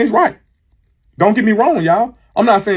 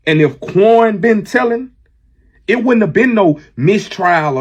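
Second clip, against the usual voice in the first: normal talking voice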